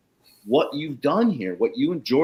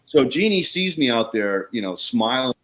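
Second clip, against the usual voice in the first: ordinary talk, nothing marked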